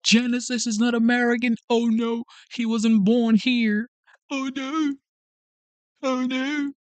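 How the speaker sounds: high-pitched imitation of a crybaby complaining; mock crying. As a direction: whine it in mockery, pretending to cry. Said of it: "you can cry and say".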